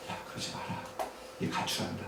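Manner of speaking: retold aside, barely voiced; very soft, whispery retold warning; hushed plea. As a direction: murmur a cautioning word to your wife. Retells hushed plea to his wife.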